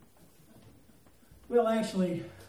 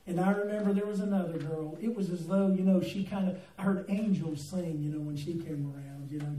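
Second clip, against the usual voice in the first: usual speaking voice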